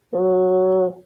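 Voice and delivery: "r" held as one long, level rrr; isolated r sound. Shown as a demonstration. Teacher swaps the beginning sound, "r" drawn out as rrr. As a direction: sustain "r" alone, the sound that starts rat. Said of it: "like a rat".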